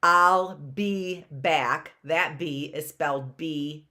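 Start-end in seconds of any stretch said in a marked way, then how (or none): 0.0-1.9 I'll be back dragged out slowly, leaning on be; slow demo. Shown as a demonstration. Teacher slowly demonstrates the word be in a sentence. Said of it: "You have to remember that".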